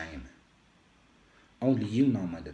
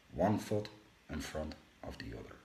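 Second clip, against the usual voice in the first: plain reading voice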